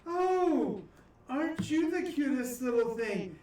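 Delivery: high sweet granny voice, slow; cooing old woman. Acted. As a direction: coo over him like a doting grandma.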